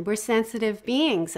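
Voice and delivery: usual speaking voice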